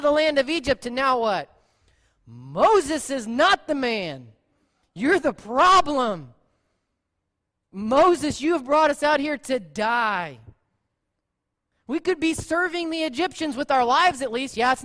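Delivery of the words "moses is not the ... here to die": loud, slow mock accusation; dramatic complaining. Crowd turning on Moses, loud hammed-up blame. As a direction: ham up the grumbling crowd. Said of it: "I was a little bit dramatic".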